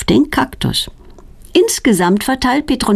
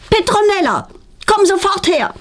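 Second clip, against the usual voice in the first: high, commanding call; calling loudly; 13 semitones higher than usual. A narrator's reading. Mother calling her daughter to come at once, high and loud. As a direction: summon her sharply from afar. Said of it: "ruft ihre Mutter laut".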